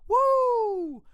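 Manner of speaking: long, loud, very high "woo"; imitated high-pitched cheer; mock cheering whoop. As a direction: let out a piercing celebratory whoop. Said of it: "Sophie wooed behind me".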